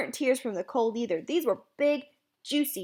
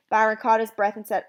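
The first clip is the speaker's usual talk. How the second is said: ordinary delivery, nothing marked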